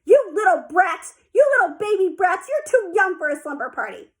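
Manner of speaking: loud, high scolding yell; older sister hollering at little girls; hollered. A narrator's reading. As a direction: yell it down at the kids. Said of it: "She hollered".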